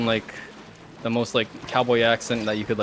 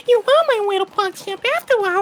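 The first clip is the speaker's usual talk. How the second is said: very high, louder cartoonish squeal; squeaky high voice; 5 dB louder than usual. Conceding the bet to his teammate, high squeaky voice. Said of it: "squeaky high voice to friend".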